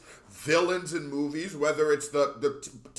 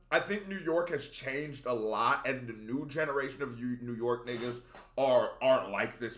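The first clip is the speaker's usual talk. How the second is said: normal talking voice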